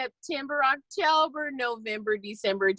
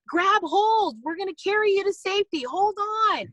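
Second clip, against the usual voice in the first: yelling down; 10 semitones higher than usual. Retold or imitated. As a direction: shout it down to the man on the roof. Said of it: "yells down".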